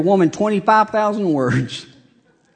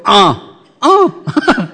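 laughing grunt; 4 semitones higher, 4 dB louder than usual. Retold or imitated. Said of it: "Just go".

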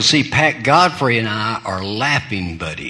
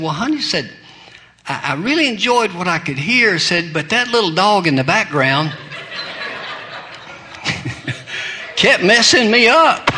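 put-on sweet old lady; 5 semitones higher than usual. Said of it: "very prim, proper, sweet".

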